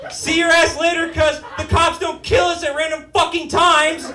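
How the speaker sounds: high, loud comic yelling; hammed-up shouted send-off; mock gleeful shout. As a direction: holler it as a carefree taunt. Mock carefree farewell, shouted.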